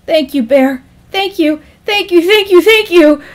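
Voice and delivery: overwhelmed, escalating gratitude; grateful. Acted. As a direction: pour out relief to your rescuer. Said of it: "in grateful prayer".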